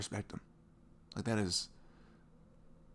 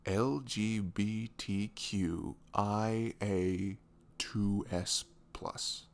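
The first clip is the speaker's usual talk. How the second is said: slow letter by letter recital; deliberate careful listing; much slower than usual. Real, not acted. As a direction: spell out each letter slowly. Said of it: "I got the rest of the letters".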